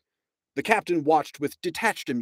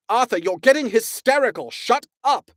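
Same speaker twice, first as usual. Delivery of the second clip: higher, loud impatient scold; sharp rebuke; 11 semitones higher, 6 dB louder than usual. A narrator's reading. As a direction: cut him off firmly. Ford silencing panicking Arthur.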